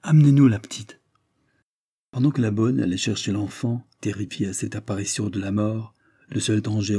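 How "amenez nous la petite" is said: un cri soudain, plus fort et un peu plus haut; lancé d'un coup, pressant; s'écrie. A narrator's reading. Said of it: "qui s'écria tout de suite". (s'écrie soudain)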